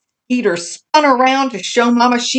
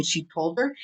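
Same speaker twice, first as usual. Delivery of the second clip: ordinary delivery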